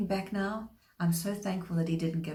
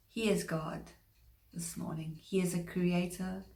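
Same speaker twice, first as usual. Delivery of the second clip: ordinary delivery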